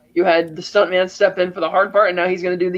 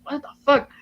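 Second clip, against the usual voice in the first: higher, disbelieving what the fuck; stunned what the fuck; 7 semitones higher than usual. Retold or imitated. Jay hearing Rick torched a hippie, stunned. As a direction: recoil in shocked disbelief. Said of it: "He's like".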